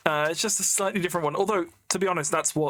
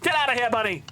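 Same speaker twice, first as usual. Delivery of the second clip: raised, higher-pitched shooing call; 5 semitones higher, faster than usual. Real, not acted. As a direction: shoo it away urgently. Shooing a bunny in the game.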